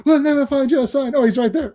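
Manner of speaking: high-pitched mock cartoon voice; cartoon Canadian. Cartoon Canadians hunting for a son, high mock voices. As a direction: lightly imitate a silly cartoon Canadian. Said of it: "I love the Canadians in South Park".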